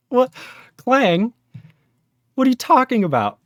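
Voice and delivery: outraged at the bad docs; exasperated rant. Real, not acted. Ranting about Clang docs.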